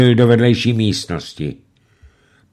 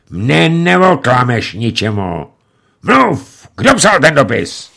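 louder, higher, slow threat; menacing; 6 semitones higher, 6 dB louder, slower than usual. A narrator's reading. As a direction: gun in hand, demand an answer. Bill threatens with revolver. (výhrůžně, hlasitě)

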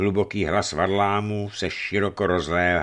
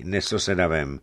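plain reading voice

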